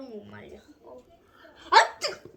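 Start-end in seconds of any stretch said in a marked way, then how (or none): 1.7-2.3 hot food yelp. Real, not acted.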